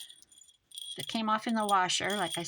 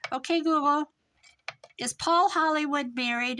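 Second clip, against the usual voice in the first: loud, higher call to the speaker; calling the assistant; 7 semitones higher, 6 dB louder than usual. Retold or imitated. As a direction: call out to the Google device.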